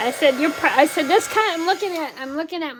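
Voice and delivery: loud, high-pitched holler. Real, not acted. Crafter repeating themselves to a listener who said "huh", hollering. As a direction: holler it so they hear over the noise. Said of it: "Sorry, guys, if I'm hollering".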